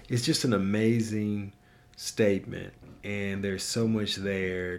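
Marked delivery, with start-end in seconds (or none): none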